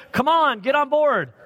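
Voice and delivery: slow, high, pushy coaxing; coaxing Pharisees. Retold or imitated. Pharisees pressing the healed man, slow pushy coaxing. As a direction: lean on him to join in. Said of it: "He's like".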